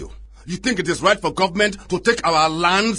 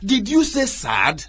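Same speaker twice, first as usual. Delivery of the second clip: incredulous anger; 6 semitones higher than usual. Acted. Chief incredulous at the word sad.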